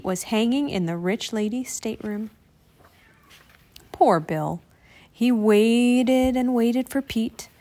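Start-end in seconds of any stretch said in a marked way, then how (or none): none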